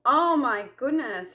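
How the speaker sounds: a rush of humiliation; mortified. Retold or imitated. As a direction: gasp it out, embarrassed. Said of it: "very humiliating".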